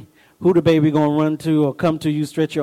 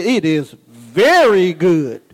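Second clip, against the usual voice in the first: slow solemn voice of God; 4 dB louder, much slower than usual. Retold or imitated. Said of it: "he said".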